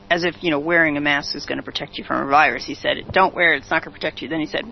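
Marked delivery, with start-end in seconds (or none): 3.1-4.3 rattled off fast, mocking; mock quote. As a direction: dash it off like a flimsy order. Mocking Fauci's flip-flop.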